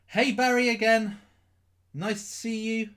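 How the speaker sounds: high-pitched, put-on Scottish accent. Streamer greeting Barry in the chat, Scottish accent. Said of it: "What's with the Scottish?".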